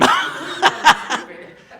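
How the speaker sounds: high loud laugh mid-line; burst of laughter. Real, not acted. Speaker at lodge, laughing loudly at own story. Laughing through retold greeting.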